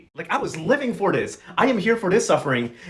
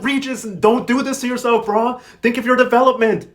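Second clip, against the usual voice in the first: higher-pitched mock pleading with a character; exasperated pleading; 6 semitones higher, 4 dB louder than usual. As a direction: plead like a let-down fan. Viewers plead with Regis.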